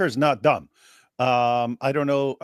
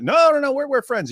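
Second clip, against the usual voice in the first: high-pitched, hurried mock denial; feigned friendly reassurance; 13 semitones higher, 4 dB louder than usual. Deceiver placating a suspicious local, falsely reassuring. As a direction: protest innocence in a raised, put-on voice.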